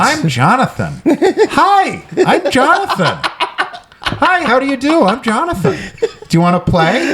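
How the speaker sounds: squeaky-high mock kid greeting; high-pitched little kid. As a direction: introduce yourself like a friendless eight-year-old. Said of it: "Did you have a high-pitched voice".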